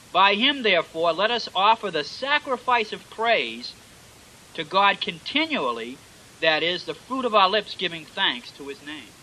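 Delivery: preacher thundering out Hebrews to his flock; scripture reading. Acted. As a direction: proclaim the verse to the whole church. Reads scripture aloud to congregation.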